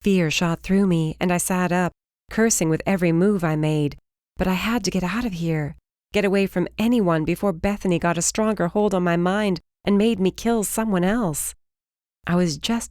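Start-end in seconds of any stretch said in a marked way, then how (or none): none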